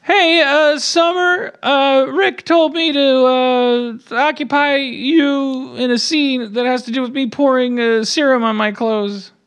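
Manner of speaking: put-on high, nervous teen boy voice; Morty voice. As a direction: stammer high like a nervous kid.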